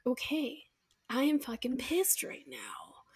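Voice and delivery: slow, hushed admission of anger; quietly enacted seething; contained anger. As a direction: say it slowly under your breath, acting pissed off. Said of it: "move from being angry".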